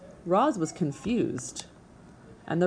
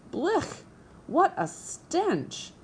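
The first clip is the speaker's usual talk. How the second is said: much higher, slow, stretched-out yelp of disgust; disgusted badger; 11 semitones higher, much slower than usual. A narrator's reading. Badger recoiling from a rotten corpse, high disgusted yelp. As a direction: recoil from the stink with a high drawn-out groan. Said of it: "the badger's face twisted with disgust".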